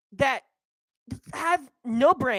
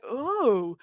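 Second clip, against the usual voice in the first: lightly voiced lazy drawl; mild mocking voice of complacent people; mock quote; 5 semitones lower, slower than usual. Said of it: "people are like".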